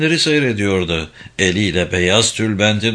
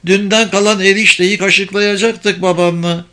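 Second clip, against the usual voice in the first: put-on high, fretting old mother; acted domestic panic, pitched high; flustered; 8 semitones higher, 4 dB louder than usual. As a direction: fret aloud in a high voice. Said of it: "Telaşlandı kadın".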